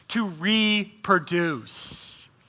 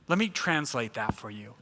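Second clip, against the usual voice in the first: quiet aside; 11 semitones lower, 10 dB quieter than usual. Real, not acted.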